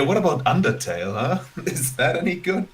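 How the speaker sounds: normal talking voice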